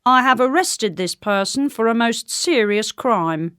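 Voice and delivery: put-on formal reporting voice; soldier. A narrator's reading. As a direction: report an arrest to royalty. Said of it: "said the soldier".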